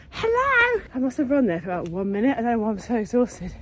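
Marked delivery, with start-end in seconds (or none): 0.1-0.8 high, bright mock greeting; mock breathless hello. Vlogger shows how she won't arrive, mock high hello. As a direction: burst in with a shrill pretend hello. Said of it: "So I'm not like".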